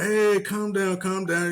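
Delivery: a hint of a host telling her to calm down; imitating the host. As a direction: raise the voice a bit on hey, then settle. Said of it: "Antoine's like".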